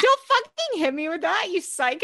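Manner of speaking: high-pitched panicky protest; a hint of terrified squash player; mock panicked yell. Friend playing squash, yelling at the opponent in mock panic. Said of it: "I'd be like".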